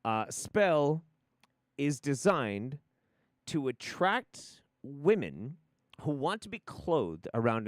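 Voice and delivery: slow, deliberate salesman phrasing with big pauses; Mad Men pitch. Acted. Rewording the spell's marketing, measured ad-man pitch. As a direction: sell each phrase like an ad executive. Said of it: "I'm gonna go Mad Men for this one".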